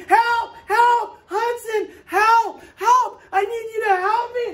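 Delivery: high, loud frantic yelling for help; desperate cries for help. Retold or imitated. A neighbour screams for Hudson's help at his door, high and frantic. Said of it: "a man was screaming".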